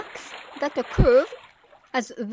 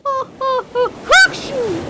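high rising ha-ha building to a loud achoo; whale sneeze; 11 semitones higher, 5 dB louder than usual. Acted. Whale sneezing the shrimp out of its nose, loud. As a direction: wind up and blast the sneeze.

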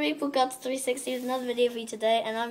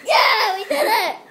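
excited cheer; 8 semitones higher, 8 dB louder, slower than usual. Real, not acted. Triumph after getting lifted.

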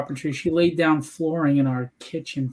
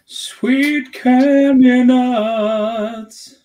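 higher, very drawn-out shout; loud exclamation; 8 semitones higher, 9 dB louder, much slower than usual. Real, not acted. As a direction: stretch every word into a big slow holler. Exclaims over artwork sent to him.

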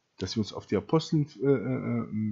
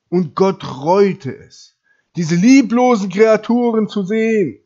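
loud, drawn-out preacher's shout; full-force sermon climax; thundering preaching; 7 semitones higher, 11 dB louder, slower than usual. Real, not acted. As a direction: build to a high, sustained cry.

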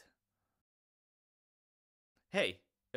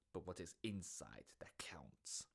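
whisper; 9 semitones lower, 11 dB quieter than usual. Acted. Secretive aside to listener.

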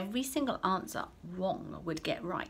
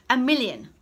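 high, loud eager call; eager child; 6 semitones higher, 11 dB louder than usual. Acted. Pupil calling out an answer, eager and loud. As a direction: shout it out like a keen pupil. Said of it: "said one boy".

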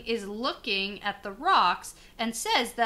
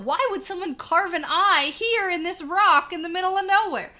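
higher, louder incredulous question; retold yell; 7 semitones higher, 5 dB louder than usual. Retelling Egwene's question about the carved rock, mock yell. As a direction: imitate someone calling out in disbelief. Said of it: "Egwene sort of yells out".

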